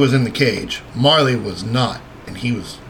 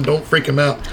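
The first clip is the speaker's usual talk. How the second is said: ordinary talk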